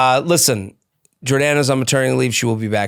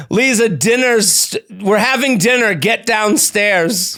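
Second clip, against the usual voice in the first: mock parent calling; 7 semitones higher, slower than usual. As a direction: call her down to dinner like a fed-up parent. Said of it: "teach me how to say".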